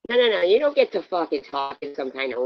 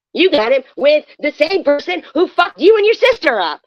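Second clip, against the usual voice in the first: real screaming rant, higher and much louder; raging shout at the rival streamer; furious yelling; 5 semitones higher, 7 dB louder than usual. Real, not acted. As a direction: yell every accusation at her. Heated livestream quarrel, yelling.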